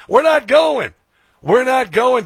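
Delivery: loud and high, digging in hard; defiant shout. Real, not acted. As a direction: refuse loudly, like a rally cry. Defiant rant against opponents.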